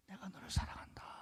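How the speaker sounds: soft unvoiced whisper; God whispering love to a weary believer. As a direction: breathe it like a secret from heaven.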